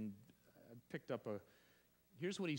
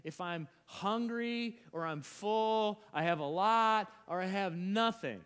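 Paul declaring boldly; 6 semitones higher, 8 dB louder than usual. Acted. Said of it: "that's why Paul goes".

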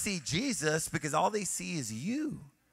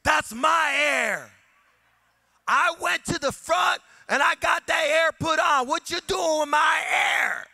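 much higher, loud, slow and shouted; furious shout; 12 semitones higher, 10 dB louder, slower than usual. Retold or imitated. Angry woman yelling at the pastor over the air hose, shouting. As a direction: yell it like she owns the pump. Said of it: "And she goes".

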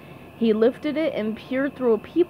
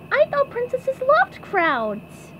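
slow, very high cartoon voice; SpongeBob puzzled; 13 semitones higher, slower than usual. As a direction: say it puzzled, in a squeaky pipsqueak voice.

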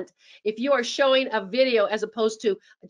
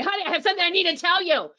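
high, fast and tense; alarming tone; 8 semitones higher, much faster than usual. Shown as a demonstration. Spouse blurting news the wrong way, high and fast. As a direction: blurt it out like trouble is coming. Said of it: "We don't say".

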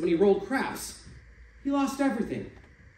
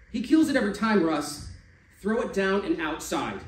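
heated; 5 semitones higher, 6 dB louder than usual. Acted. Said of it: "Kevin's hot".

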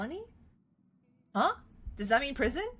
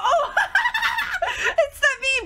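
high laugh bursting into words; laughing shout; 5 dB louder, slower than usual. Real, not acted. Laughing at the meme.

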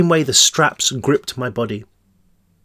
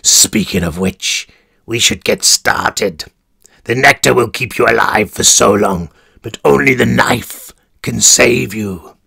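sinister warden; 2 semitones lower than usual. Acted. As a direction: purr it like a cruel warden. Warden begins procedure.

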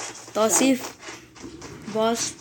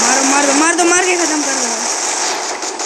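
louder, drawn-out battle yell; shouting; 2 semitones higher, 4 dB louder, slower than usual. Real, not acted. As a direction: yell to finish him off. Yells to finish enemy.

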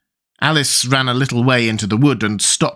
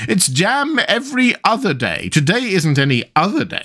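high queen's voice, matter-of-fact; 9 semitones higher than usual. A narrator's reading.

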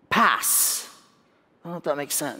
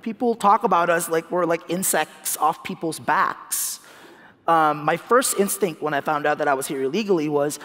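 ordinary delivery

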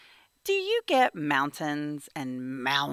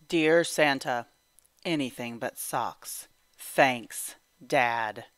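lower, deadpan grown-up; dry dad voice; 4 semitones lower than usual. Acted. Dad adding his own note to Santa, lower and deadpan. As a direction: say it like a tired father joking.

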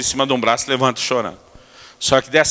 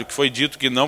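ordinary talk

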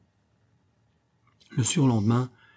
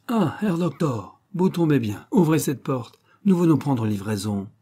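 menacing irony; 5 semitones higher, 4 dB louder than usual. Acted. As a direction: greet him like prey. Schiller greets Louis menacingly.

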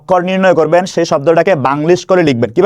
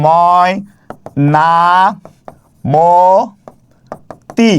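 ময়, না, মো, তি each alone, long pauses between; very slow, widely spaced sounding out of ময় না মো তি; syllable by syllable; 2 semitones lower, much slower than usual. Shown as a demonstration. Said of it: "উচ্চারণ করার সময় যেখানে যেখানে থামি". (ময় না মো তি থেমে থেমে)

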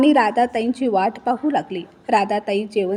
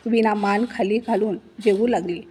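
plain reading voice

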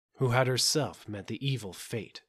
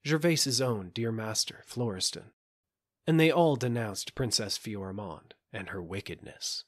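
ordinary delivery, nothing marked

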